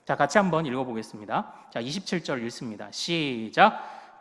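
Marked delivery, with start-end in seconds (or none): 2.9-4.2 long drawn-out start cue; reading cue. Real, not acted. Preacher cueing the congregation to read verse together, drawn out. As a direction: stretch it out to bring everyone in.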